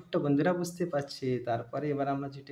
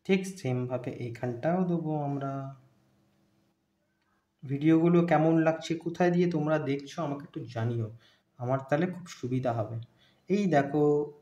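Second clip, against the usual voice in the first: ordinary talk, nothing marked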